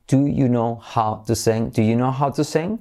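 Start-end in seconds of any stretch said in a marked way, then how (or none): 0.1-1.7 slow. Shown as a demonstration. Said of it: "Lo digo despacio".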